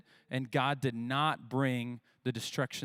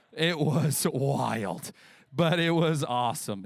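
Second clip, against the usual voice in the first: laughing; 4 dB louder, slower than usual. Real, not acted. Laughing remembering hot camp.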